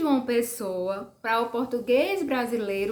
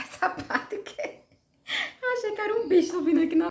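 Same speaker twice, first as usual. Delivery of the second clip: laughing; 8 semitones higher than usual. Real, not acted. Laughing at a bug on her.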